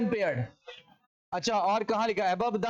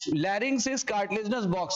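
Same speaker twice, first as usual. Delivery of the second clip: slow dictation read; much slower than usual. Shown as a demonstration. Teacher slowly reads textbook line.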